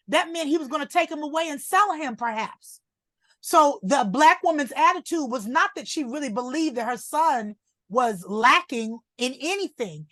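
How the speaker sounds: normal talking voice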